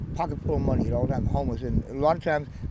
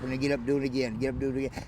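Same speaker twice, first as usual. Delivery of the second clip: ordinary delivery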